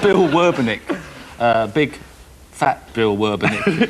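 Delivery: loud, laughing name call. Real, not acted. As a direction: blurt the name out through laughter.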